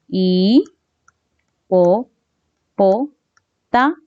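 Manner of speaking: hipopótamo split into isolated syllables; "i, po, po, ta, mo" with a clear pause after each syllable. Shown as a demonstration. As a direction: dictate it one syllable at a time. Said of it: "¿Cuántas sílabas?".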